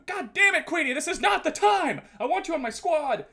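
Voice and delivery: high, loud hammed-up scolding; mock outrage. Streamer scolding a friend whose Xbox needs updating, mock outrage. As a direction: blow up in playful exasperation.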